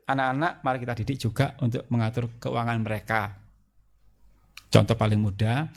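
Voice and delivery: ordinary talk, nothing marked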